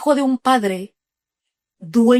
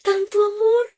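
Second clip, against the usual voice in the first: awed cry to God; 7 semitones higher, slower than usual. Retold or imitated. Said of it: "yo me quedo así como".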